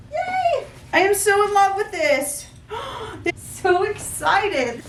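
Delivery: high, giddy gushing; giddy squeal. Real, not acted. Gushing over the bag, high and giddy.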